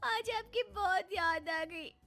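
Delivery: put-on sadness. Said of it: "उन्होंने एक आंसू गिराया नहीं कि पिघल गई".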